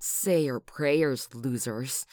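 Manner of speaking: loud slow deliberate threat; slow gloating menace; menacing threat. Acted. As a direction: drag each word out to scare them. Threatening attackers.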